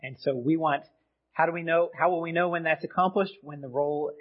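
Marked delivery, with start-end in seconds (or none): none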